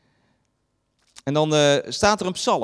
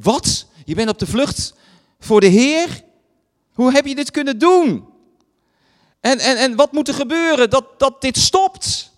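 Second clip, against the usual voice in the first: higher and louder, aghast disbelief; horrified crew crying out at Jona; slowing to a pleading end on the last words; shocked frightened sailors; 6 semitones higher, 6 dB louder than usual. Retold or imitated. Sailors shocked at Jona's flight.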